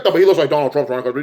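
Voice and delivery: ordinary talk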